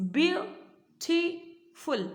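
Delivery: beautiful in three slow pieces, BEAU... TI... FUL; split into syllables. Shown as a demonstration. Teacher showing the three parts of beautiful, syllable by syllable. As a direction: pause between each part of beautiful. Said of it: "तीन भाग में।".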